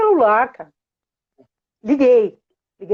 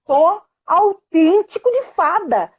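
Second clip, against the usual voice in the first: grand declaration; 7 semitones higher, slower than usual. Retold or imitated. Mother insisting on fairy dust.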